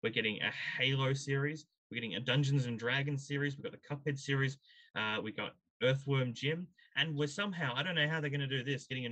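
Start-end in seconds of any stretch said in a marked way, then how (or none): none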